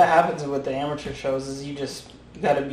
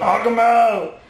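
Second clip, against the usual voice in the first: long, drawn-out mock shout; loud, high and slow ringside holler; calling out; 8 semitones higher, 5 dB louder, much slower than usual. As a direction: stretch it out like a rowdy fight fan. Repeats the spectator's shout.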